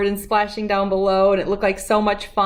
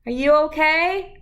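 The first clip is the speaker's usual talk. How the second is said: dad calling up to the ladder, higher; 5 semitones higher than usual. Acted. Dad calls up to Jabari on the ladder, calling out. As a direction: call out to the boy on the ladder. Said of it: "called his dad".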